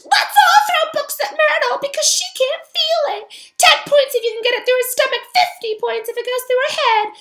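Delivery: very high, loud sarcastic shriek; shrieking ghost girl. Acted. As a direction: shriek your bitter mockery. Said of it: "puffed herself up and shrieked".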